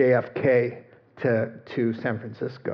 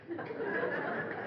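soft laugh; 13 dB quieter than usual. Real, not acted. Laughing after thank you Jesus.

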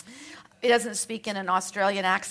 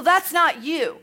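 ordinary talk, nothing marked

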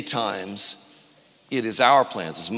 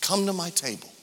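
much softer, near-hushed repeat; soft echo; 6 semitones higher, 6 dB quieter than usual. Acted. Pastor repeating Jesus' invitation to the church, hushed. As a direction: let the invitation sink in gently.

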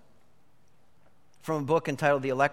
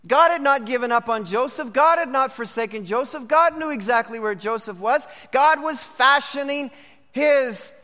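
rising, louder preaching build; 6 semitones higher, 4 dB louder than usual. Acted. Preacher urging the congregation never to give up, rising louder. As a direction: press the congregation hard, louder toward the end.

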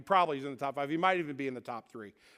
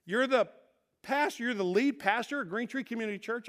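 higher voice of dawning recognition; imitating a nurse who suddenly realises who this is; changed voice; 7 semitones higher than usual. Nurse realises her patient is the pastor, voice changed. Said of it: "And her voice changes".

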